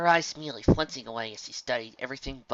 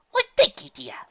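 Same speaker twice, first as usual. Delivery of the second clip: very high, loud flattered lady; delighted elegant mare; Rarity beaming; 9 dB louder than usual. Acted. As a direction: purr the thanks grandly. Rarity beams.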